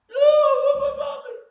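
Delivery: put-on very high, full-blast child's shriek; mock screaming cry. Boy refuses his father's argument, mock screaming wail. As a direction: bawl it out in a hammed-up tantrum. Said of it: "And he's crying".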